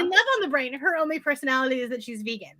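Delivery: usual speaking voice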